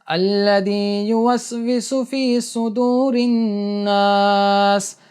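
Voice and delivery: slow, drawn-out chanted recitation of Surah An-Nas; slow Quran recitation. Shown as a demonstration. Teacher recites for students to copy, slow chanted recitation. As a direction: recite each verse slowly and melodically for the learner. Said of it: "سنو غور سے".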